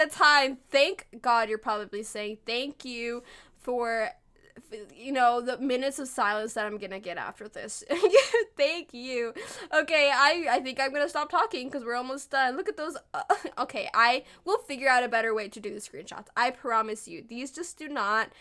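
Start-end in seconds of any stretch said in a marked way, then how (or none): none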